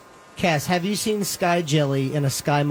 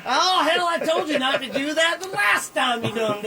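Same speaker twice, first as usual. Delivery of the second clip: much higher hillbilly shout; 12 semitones higher than usual. Pretend. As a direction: yell at him like a cartoon cowboy boss. Playing the angry boss.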